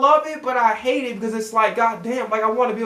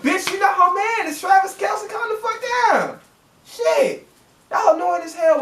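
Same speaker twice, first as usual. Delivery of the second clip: much higher, fed-up yell; exasperated outburst; 10 semitones higher than usual. Real, not acted. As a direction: climb high telling them to calm down. Ranting at broadcasters about Taylor.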